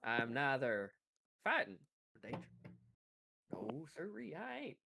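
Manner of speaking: lightly drawled southern gentleman; southern accent. Shown as a demonstration. Game master rehearsing the hunter's denial, southern accent. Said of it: "his deep southern accent".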